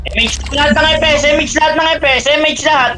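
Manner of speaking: high, fast excited call-out; excited shout. Real, not acted. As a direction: hype it up, pitch way up. Excited call during game fight. (masiglang sigaw)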